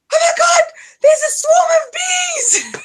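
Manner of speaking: shrieking high and loud at the bees; alarmed shriek. Retold or imitated. Mother-in-law spotting the bee swarm, high panicked shout. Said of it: "she's like".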